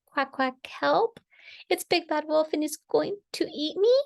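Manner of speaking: high, frightened little duckling; crying for help. A narrator's reading. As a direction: squeak out alarm as a tiny duckling. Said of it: "cried number five".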